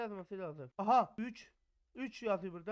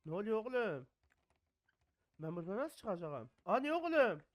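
ordinary talk, nothing marked

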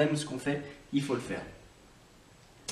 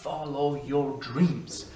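slow encouragement; much slower than usual. Shown as a demonstration. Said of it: "Et toi aussi".

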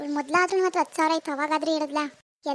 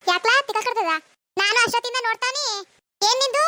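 higher, sharp angry retort at a stranger; angry scolding; 5 semitones higher than usual. Acted. Woman at bus stop rebukes a flirting stranger, angrily. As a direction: put the pest in his place.

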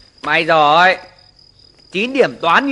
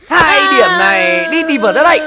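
furious shout; 5 semitones higher, 4 dB louder than usual. Acted. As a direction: bellow each word as punishment. Father punishing child for bad grade. (hét lên giận dữ)

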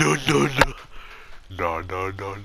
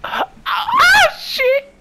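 high strained rage yell; raging gamer; 7 dB louder, much slower than usual. Real, not acted. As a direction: scream in gamer frustration. About to die in game.

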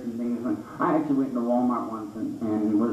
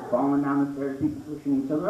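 normal talking voice

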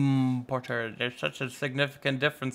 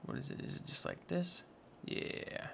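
normal talking voice

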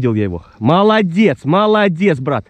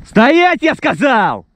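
angry shout; 4 dB louder, much slower than usual. Real, not acted. Stopping the dog again.

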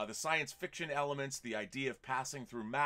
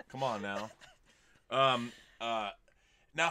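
ordinary delivery

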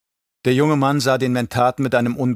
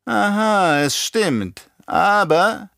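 high mocking echo; nachäffend, triumphierend; 9 semitones higher, much slower than usual. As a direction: seize on the admission and throw it back, pitched up. Baron echoes nephew's words back. (hoch und spöttisch nachgeäfft)

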